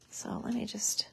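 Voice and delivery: breathy aside under her breath; whispered to self. Real, not acted. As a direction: whisper it to yourself. Muttering to herself while crafting.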